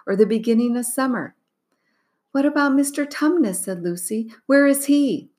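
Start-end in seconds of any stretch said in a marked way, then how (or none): 2.3-3.6 put-on high, worried child's voice; Lucy asking urgently; anxious little girl. Lucy character voice. 4.5-5.3 put-on high, worried child's voice; Lucy asking urgently; anxious little girl. Lucy character voice.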